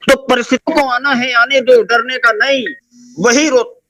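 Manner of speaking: rally the crowd, stretching the last words; rousing, high-pitched closing declamation; motivational oratory. Real, not acted. Rallying close of speech.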